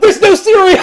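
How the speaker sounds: laughing high protest. Real, not acted.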